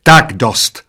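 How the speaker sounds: loud, sudden cutoff; restrained screech of enough; waitress yelling. A narrator's reading. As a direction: put a stop to it with a yell. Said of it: "zaječela číšnice".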